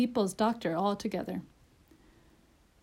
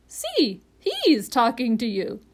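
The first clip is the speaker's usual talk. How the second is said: parrot, pointing out; 2 semitones higher, 6 dB louder, slower than usual. A narrator's reading. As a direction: draw the doctor's attention sharply. Voices Polynesia the parrot.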